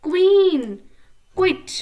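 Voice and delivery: Queen called out high and loud; loud model word. Shown as a demonstration. Teacher drilling pupils, calling the word loud and high. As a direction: sing out queen for the class to echo. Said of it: "तेज तेज बोलना है".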